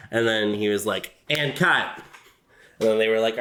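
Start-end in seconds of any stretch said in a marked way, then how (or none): 1.3-2.0 higher-pitched mock director's call; lightly acted director; film director impression. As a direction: call the cut on set. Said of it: "like, my impression of them, like, filming".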